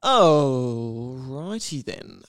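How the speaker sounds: very slow, swooping 'alrighty then'; long, elastic, comic drawl; drawn-out catchphrase. Real, not acted. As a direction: stretch it right out, sliding down and back up.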